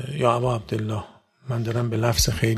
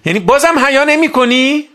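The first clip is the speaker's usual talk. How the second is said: shouted much higher and louder; furious rebuke; 12 semitones higher, 8 dB louder than usual. Acted. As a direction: hurl the reproach at the attacker. Rebuking the enemy attacking.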